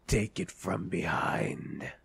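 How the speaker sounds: slow sneaky whisper. Acted. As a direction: breathe it like a stalker. Stalking the rhino.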